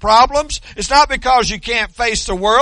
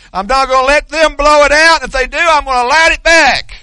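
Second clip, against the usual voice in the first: raised, louder preacher's resolve; defiant declaration; 4 semitones higher, 5 dB louder than usual. Acted. Preacher models courage for the congregation, defiant declaration. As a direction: ring it out high and loud, determined.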